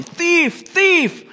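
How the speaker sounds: high mock yell of thief. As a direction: cry thief in a shrill pitch jump, hammed up. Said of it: "start yelling".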